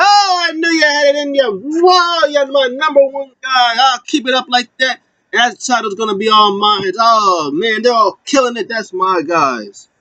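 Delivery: very high, overexcited imitation; mock gushing praise. Manager hailing his number one guys, overjoyed. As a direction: gush with exaggerated delight, voice way up.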